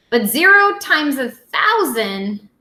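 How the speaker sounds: ordinary delivery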